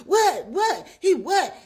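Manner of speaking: high, loud barked questions; mock yelling. Woman losing it at Anthony, retold yelling. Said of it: "And she yelling at him".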